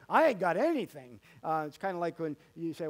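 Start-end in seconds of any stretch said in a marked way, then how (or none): 0.1-1.2 mock busted man. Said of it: "he would say in intensification".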